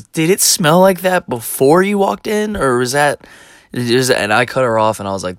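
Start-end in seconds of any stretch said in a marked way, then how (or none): 0.0-3.3 higher, louder, slow disbelief; lightly voiced boss aghast at the smell; mock appalled question; incredulous boss. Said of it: "And she goes".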